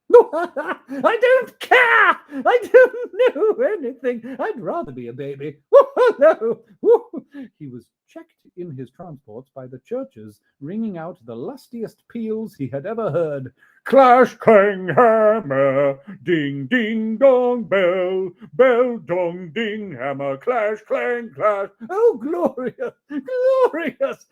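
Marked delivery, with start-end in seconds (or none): 0.1-6.6 high, loud, through laughter; laughing Scrooge. Acted. 6.8-7.6 high, tearful whoop of a laugh; joyful laugh. Acted. Scrooge laugh. 13.8-21.7 loud, ringing bell calls; bell sounds. Acted. Scrooge imitating bells. 21.9-24.3 very high, slow, tearful rapture; rapturous Scrooge. Acted.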